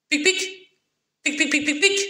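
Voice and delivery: very high squeaky pics; squeaky squirrel pic. A narrator's reading. Storyteller squeaking for the squirrel.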